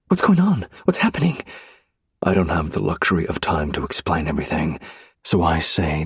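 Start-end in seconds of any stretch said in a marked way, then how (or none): 0.1-1.4 high, loud frightened questions; panicked questions. Acted. Wife seeing husband arrested, panicked questions. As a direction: ask in panic.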